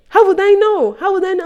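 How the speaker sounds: very high-pitched mock protest; imitating shocked teacher. Shocked teacher answering student who talked back, high-pitched imitation. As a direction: squeak it out in mock defensiveness. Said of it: "she was shocked".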